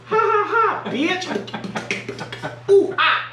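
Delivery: high, loud, slow name call then shrieking laughs; hyped goofy outro. Acted. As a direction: clown it up for the outro. Goofy sign-off shouting.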